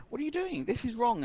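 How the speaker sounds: put-on frightened girl backing away; alarmed protest. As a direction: recoil and cry out in alarm. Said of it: "She's like".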